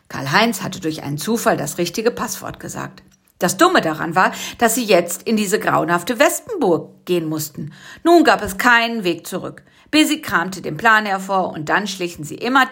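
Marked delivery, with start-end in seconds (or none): none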